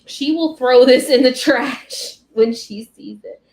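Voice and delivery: loud imitation of a firm mother; emphatic warning. Mom warning aunt about the gift, emphatic.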